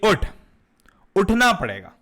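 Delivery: loud, short wake-up order; lightly voiced father. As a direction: imitate a father ordering a child up.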